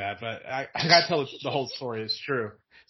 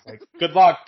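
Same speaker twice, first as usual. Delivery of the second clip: loud, sarcastic good luck; mocking good luck; 4 semitones higher, 12 dB louder than usual. Teen mocks his tiny grandmother, loud and sarcastic. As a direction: throw it at her with a scoff.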